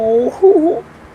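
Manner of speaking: high, loud laugh that breaks out; loud burst of laughter. Real, not acted. Vlogger cracking up at her own story, loud laugh. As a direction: let the laugh explode.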